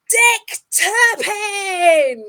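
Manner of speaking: loud, slowed, drawn-out reveal of the name; dramatic reveal. Acted. Host revealing the famous criminal to her guest, loud dramatic reveal. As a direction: announce it like a big unmasking.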